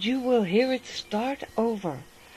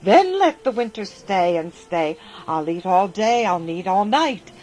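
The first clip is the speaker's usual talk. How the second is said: grab at it, cry for more and more; greedy cry; 9 dB louder than usual. A narrator's reading. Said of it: "he said".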